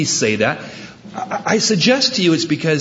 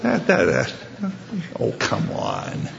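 mock skeptical scoff; 6 dB quieter than usual. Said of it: "and you go".

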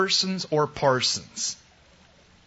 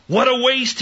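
Judas's scornful cry, pitched up high and loud; scornful outcry; 12 semitones higher, 6 dB louder than usual. Retold or imitated. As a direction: spit it out as a disgusted complaint. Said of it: "he said".

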